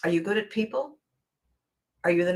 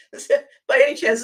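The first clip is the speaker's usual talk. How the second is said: usual speaking voice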